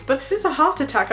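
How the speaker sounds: ordinary talk, nothing marked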